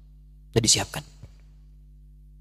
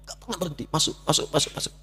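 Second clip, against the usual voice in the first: almost whispered, breathless repetition; hushed awe; 4 semitones higher, 6 dB quieter than usual. Acted. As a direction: let the words slip out in a hushed rush. Verses flowing in unstoppably.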